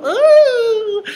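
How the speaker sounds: piercing high mock shriek, loud; mock scream. Mocking the movie woman's scream, a high loud shriek.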